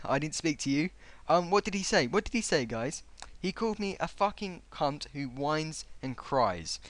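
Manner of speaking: ordinary talk, nothing marked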